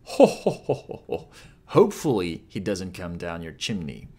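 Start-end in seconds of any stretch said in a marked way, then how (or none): 0.0-1.3 high, loud burst falling away into breath; belly out a mutated Santa's chant; hammed-up Santa laugh, trailing off. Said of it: "Out of his neck, he is uttering".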